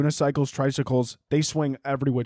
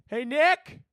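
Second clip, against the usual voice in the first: high-pitched call to another room; 13 semitones higher than usual. Real, not acted. Podcaster calling to someone in the house, calling out high. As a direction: call out to check who's there.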